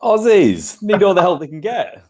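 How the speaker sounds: high, slow hammed-up Australian accent; mock Australian accent. Guest in Aussie fancy dress, mock Australian accent. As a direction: drawl it like a cartoon Australian fan.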